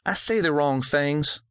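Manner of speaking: a hint of an eleven-year-old girl; young girl voice. A narrator's reading.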